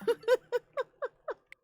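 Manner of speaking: high squealing laugh; loud burst of laughter. Real, not acted. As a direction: crack up loudly. Cracks up at own joke.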